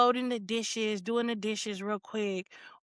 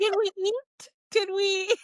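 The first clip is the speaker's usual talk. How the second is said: sugary high voice; 7 semitones higher, slower than usual. Retold or imitated. Overly sweet phone voice.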